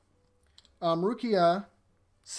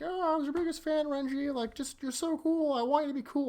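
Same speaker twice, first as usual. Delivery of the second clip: much higher gushing fan voice; gushing fanboy; 11 semitones higher than usual. Retold or imitated. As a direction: squeal it like a starstruck fan.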